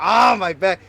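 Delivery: loud, high mock cry of an aching back; hammed-up old-age ache; mock pain. Mock aches of getting old.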